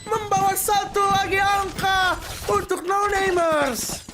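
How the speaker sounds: slow, high, drawn-out victory shout; fighting game announcer. Real, not acted. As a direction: belt out the round win, stretching it. Round won, caster shouting.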